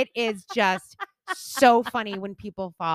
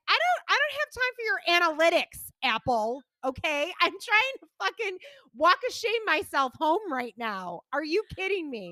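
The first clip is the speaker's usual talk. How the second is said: higher, exasperated mock rant at the watch; 9 semitones higher than usual. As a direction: scold the gadget in disbelief. Ranting at Apple, higher.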